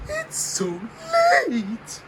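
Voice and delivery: whiny protest. Acted. Whiny complaint about late challenge.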